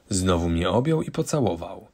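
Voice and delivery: plain reading voice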